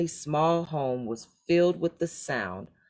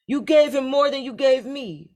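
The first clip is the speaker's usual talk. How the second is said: whining; 10 semitones higher, 6 dB louder than usual. A narrator's reading. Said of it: "whined the third son".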